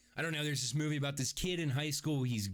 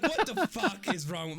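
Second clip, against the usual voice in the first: high, loud exasperated outburst; comic fury at your own weakness; mock self-anger; 8 semitones higher, 5 dB louder than usual. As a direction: berate yourself, hammed up. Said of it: "I was so fucking mad at myself".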